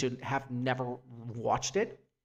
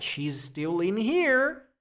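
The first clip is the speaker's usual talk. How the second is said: insistent worried friends; 8 semitones higher than usual. Retold or imitated. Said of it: "everybody was like".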